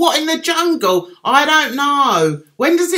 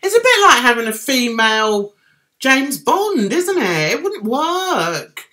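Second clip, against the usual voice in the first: normal talking voice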